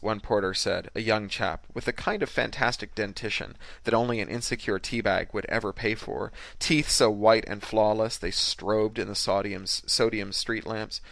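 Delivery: ordinary delivery, nothing marked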